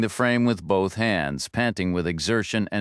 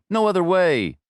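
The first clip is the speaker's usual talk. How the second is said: high, louder plea of helplessness; panicked excuse; 10 semitones higher, 4 dB louder than usual. A narrator's reading. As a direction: blurt it out in fear. Stone defends himself, panicked.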